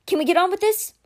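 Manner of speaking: high, chirpy question; cheerful. A narrator's reading. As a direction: cheerfully hurry everyone along. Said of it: "Karina said cheerfully".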